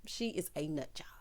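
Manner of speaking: hushed, nearly voiceless verdict; soft whispered judgment; whispered aside. Real, not acted. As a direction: drop to a whisper, as if confiding gossip. Hushed verdict on Aunt Diane.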